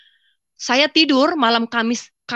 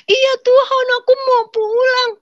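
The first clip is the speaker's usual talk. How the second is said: put-on much higher, small pleading child voice; like a little child; 5 dB louder, slower than usual. The dreamer answering God like a small child, pleading. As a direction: answer like a frightened little kid. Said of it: "kayak anak kecil di hadapan Tuhan".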